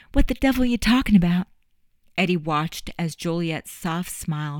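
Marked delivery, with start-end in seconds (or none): 0.1-1.5 fast, high, loud feigned shock; startled denial. Acted. Juliet pretending not to know Eddie's news, startled fast denial. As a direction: play dumb with a sudden startled burst.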